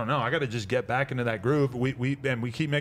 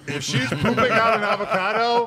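laughing; 9 semitones higher, 6 dB louder than usual. Real, not acted. Joking about gross scenario.

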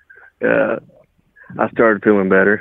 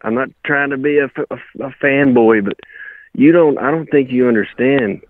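ordinary talk, nothing marked

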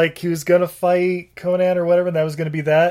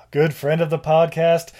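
ordinary talk

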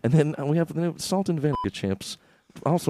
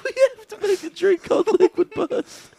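much higher silly voice; squeaky voice. Acted. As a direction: squeak the idea out. High silly voice.

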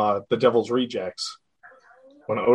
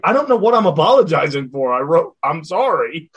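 indignant quote; 5 semitones higher, 7 dB louder than usual. Retold or imitated. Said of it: "It's like".

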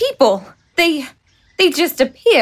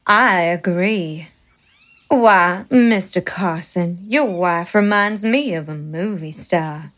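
put-on southern accent; 8 semitones lower than usual. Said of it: "Her southern accent".

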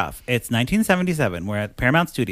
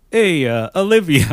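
higher, louder mock Travolta greeting; a hammed-up, admittedly bad Travolta; John Travolta impression; 5 semitones higher, 6 dB louder than usual. Host imitating the co-star greeting Olivia, a mock Travolta voice. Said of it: "I can't do John Travolta".

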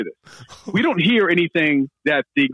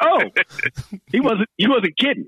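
amazed; 6 semitones higher, much slower than usual. Retold or imitated. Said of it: "I was like".

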